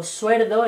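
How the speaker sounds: suerdo with the L said as R; Andalusian suerdo. Shown as a demonstration. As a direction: say suerdo, swapping L for R. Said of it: "en vez del sueldo".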